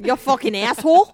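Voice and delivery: loud, high Scottish insult; mock Scottish cursing. Joining in the work rant, loud Scottish accent. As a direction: throw out a Scottish curse.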